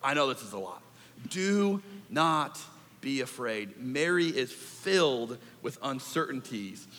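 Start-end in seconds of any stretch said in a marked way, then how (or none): 0.0-3.8 softer, gentle angel voice; reassuring angel. Acted. Angel comforting frightened Mary, soft and tender. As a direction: soothe a frightened girl, softly. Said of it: "And the angel's like".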